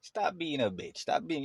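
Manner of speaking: mock scolding. Man looking at another man crying, mock scolding.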